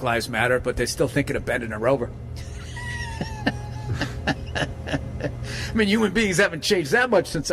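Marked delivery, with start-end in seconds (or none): 2.2-5.1 shrieking burst of laughter. Real, not acted. Laugh alone on line.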